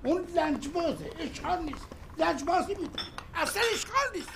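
husband insisting his stubborn wife won't answer; building indignation. Acted. Insists wife ignores the phone.

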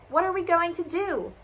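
high-pitched cry of dismay. A narrator's reading. Farm animals panicking over the sun, crying out high. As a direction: wail it in mock panic. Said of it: "they cried".

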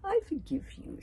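high-pitched, sweetly forgiving witch; smiling witch. A narrator's reading. Witch forgiving the red-faced searchers, smiling. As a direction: smile as you pardon them. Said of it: "smiled Exclaimnor".

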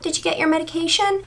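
high, little questioning voice; lilting high question, rising at the end; Carl Jacobs's little tone. Retold or imitated. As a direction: imitate a tiny, high-pitched check-in. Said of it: "like, in that little tone".

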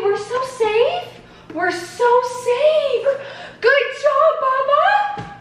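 high, slow singsong praise. Real, not acted. Mother praising baby eating.

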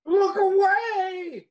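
high, loud and slow cry; shouted warning. Acted. Grace shouts to herself in horror at the bedroom door. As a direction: yell it to shield your eyes.

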